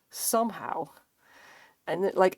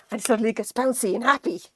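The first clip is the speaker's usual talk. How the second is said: higher, louder burst of glee; perky, hammed-up excitement; mock bouncy happiness; 4 semitones higher, 5 dB louder than usual. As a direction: act out a suddenly delighted dog. Acting out the dog's sudden joy.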